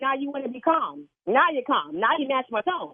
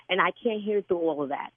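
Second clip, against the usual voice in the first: ordinary talk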